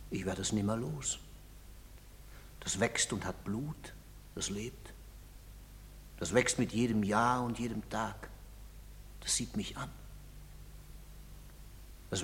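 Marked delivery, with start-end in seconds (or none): 0.1-9.9 soft, low, haunted murmur; brooding quietly. Acted. A guilty father confesses his lie to himself, quiet brooding. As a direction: speak it low, as if the guilt stares back.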